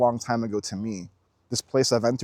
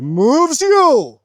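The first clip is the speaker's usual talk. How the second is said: high, loud, stretched-out swell; swept-up exclamation; 12 semitones higher, 8 dB louder than usual. Real, not acted. Telling viewers what inspiration does, loud and soaring. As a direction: let the words soar, slowly. Swept up describing being moved.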